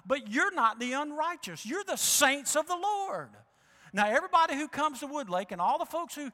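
rising preaching. Acted. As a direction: build the sermon to full force.

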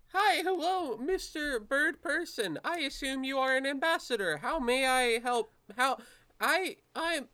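high-pitched, awkwardly formal nervous greeter; Philip. Acted. Nervous man greets a bird person in the hall, high and halting. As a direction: pitch it way up and stumble over the greeting. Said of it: "stops and says".

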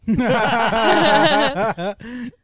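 loud, high burst of laughter; big laugh. Real, not acted. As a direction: crack up loudly, pitch soaring. Host laughs after the confession.

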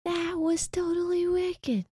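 put-on high, slow and amazed like the kid; Incredibles kid quote. Quoting the neighbor kid from The Incredibles, high awestruck kid voice. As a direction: stretch out totally wicked in a kid's awed voice. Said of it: "Do you know that from The Incredibles?".

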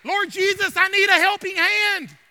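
high, loud plea for help; acted prayer cried from the pulpit; crying out. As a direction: call on Jesus as if desperate. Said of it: "come in here saying".

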